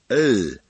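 "L" repeated, tongue tip on the upper gum ridge; L sound drill. Shown as a demonstration. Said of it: "用舌尖舔住上齿龈".

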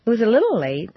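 "little" stressed and rising. Shown as a demonstration. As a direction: rise on "little" to soften the complaint. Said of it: "and little".

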